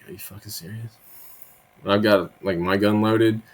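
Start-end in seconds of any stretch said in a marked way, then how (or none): 0.1-0.9 incredulous hush. Retold or imitated. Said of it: "it's just like".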